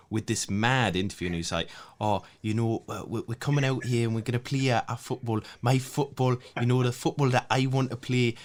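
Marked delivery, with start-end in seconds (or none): 2.0-8.4 higher, quick Geordie accent impression; Steve Bruce Geordie accent. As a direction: put on a Geordie manager's accent. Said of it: "how do you rate my Geordie accent".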